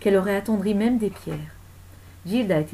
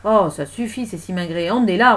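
exasperated, loud command; impatient patroness with bursts of volume; ordering; 8 dB louder than usual. Acted. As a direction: dismiss her and give the order. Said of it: "ordonna la dame patronnesse".

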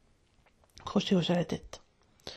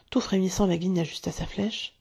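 plain reading voice

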